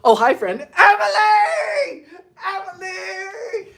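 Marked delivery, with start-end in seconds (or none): 0.8-2.1 high, drawn-out laughing shout of the name; excited greeting. Real, not acted. Greets a friend in chat. 2.4-3.7 softer call. Real, not acted. Greets friend again.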